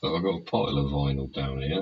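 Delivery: ordinary talk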